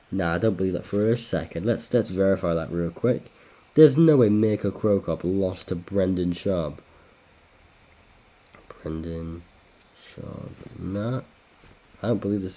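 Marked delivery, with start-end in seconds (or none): none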